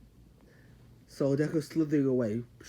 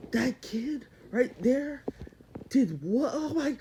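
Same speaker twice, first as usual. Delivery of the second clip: mock astonishment; 9 semitones higher, much slower than usual. Crowd shocked at the kid.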